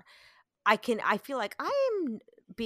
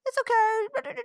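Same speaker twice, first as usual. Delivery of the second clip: high-pitched, louder comic reassurance; jokey soothing; 5 dB louder, slower than usual. Retold or imitated. Mom imitates husband clowning to calm their son, jokey. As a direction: pitch it way up to be funny. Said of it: "trying to be funny".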